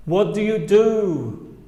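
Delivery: slow "What do you do" with linked words; slow teacher demo; slow clear model. Shown as a demonstration. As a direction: model it slowly for repeating. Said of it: "Los nativos siempre juntamos las palabras".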